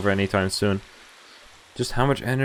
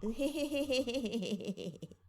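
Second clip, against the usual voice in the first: high-pitched laughing squeal; laughing hard at the voice. Real, not acted. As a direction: break into giddy high laughter. Cracking up at character voice.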